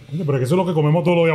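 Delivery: rushed protest at x1.7 pace; mother's fast reply. Retold or imitated. Mother objecting to the son's dinner choice, quickly. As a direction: rattle it off like a puzzled mother. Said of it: "Me dice".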